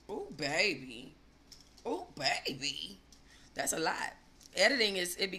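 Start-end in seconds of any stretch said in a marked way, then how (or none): 0.1-3.0 mock sympathy. Real, not acted. Reacting to three hundred DVDs.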